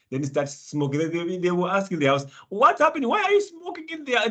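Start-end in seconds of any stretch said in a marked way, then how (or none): 2.5-4.3 high mock worried family member. A housemate shouts in surprise at the smoker. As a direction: play the puzzled family calling out. Voicing family asking about smoking.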